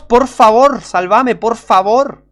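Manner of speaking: loud, high desperate plea; crying out to God. Shown as a demonstration. Preacher shows how a sinner cries out to God, loud high plea. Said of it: "tenés que clamar a Dios".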